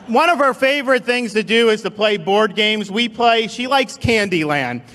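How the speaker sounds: ordinary talk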